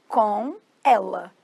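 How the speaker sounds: "Com ela" stretched, a gap between words; slow demonstration. Shown as a demonstration. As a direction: separate "Com" and "ela" slowly. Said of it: "vou falar pra vocês como que fala".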